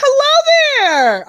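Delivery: high, loud, drawn-out hello; effusive greeting. Acted. Comedian answering a fan who spots them, effusive greeting. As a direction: sing out a big, slow greeting.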